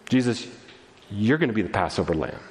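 low-pitched, measured, solemn address; heavenly Father teaching. Acted. Heavenly Father teaching young Jesus, low and solemn. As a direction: speak down low, pausing after the name. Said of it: "his heavenly Father is also teaching him".

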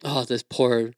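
ordinary talk

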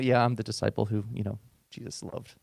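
a hint of a bragging John; smug John. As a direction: pastor imitates John boasting he was loved. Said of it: "I can see John talking".

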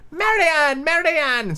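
high-pitched calling voice for the Rabbit; high character voice, summoning a servant. A narrator's reading. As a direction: call the name sharply up high, like an impatient master. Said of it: "she heard a voice outside".

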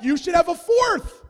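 loud mock insistence; pushy advice. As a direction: shout the nagging advice. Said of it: "other people are like".

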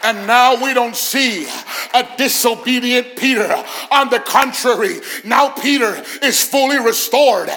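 loud, high preacher's shout, phrase by punched phrase; triumphant pulpit roar; full-force preaching. Real, not acted. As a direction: build to drawn-out screamed climaxes.

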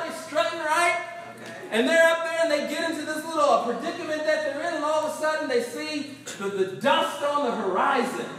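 usual speaking voice

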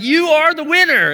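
lightly mimicked cheerful scam caller; sweet, excited caller. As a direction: sell the prize like a bubbly telemarketer. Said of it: "very sweet, very innocent sounding".

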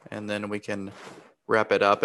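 ordinary talk, nothing marked

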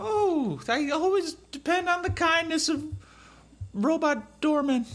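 a coy belle leaning on strangers; southern belle. Acted. Player's character answering doorman.

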